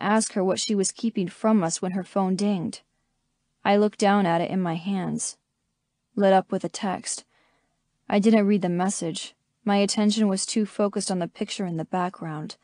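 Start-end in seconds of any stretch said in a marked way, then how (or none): none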